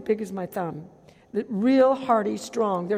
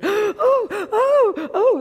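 mock crowd gasping high and loud; startled gasp; 13 semitones higher, 9 dB louder, much slower than usual. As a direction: act out a crowd gasping in alarm. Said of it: "everybody's going".

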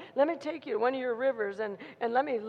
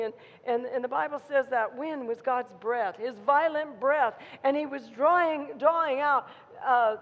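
ordinary talk

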